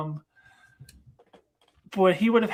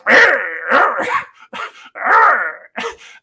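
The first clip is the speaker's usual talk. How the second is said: mock zombie wail; 4 semitones higher, 10 dB louder than usual. And he goes.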